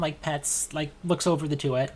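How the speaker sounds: ordinary talk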